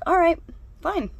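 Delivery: high, loud put-on consent; mock agreement. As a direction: act out giving in with exaggerated pep. Acting out her grudging agreement.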